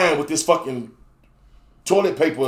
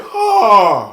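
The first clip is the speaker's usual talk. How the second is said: a sudden high, loud cry of pain; a sharp cry, pitched up and loud; 8 semitones higher, 7 dB louder than usual. Retold or imitated. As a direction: yelp out as if it just snapped. Cries out as his member breaks.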